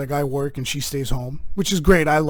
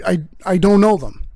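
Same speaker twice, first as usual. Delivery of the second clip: ordinary delivery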